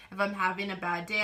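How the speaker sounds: normal talking voice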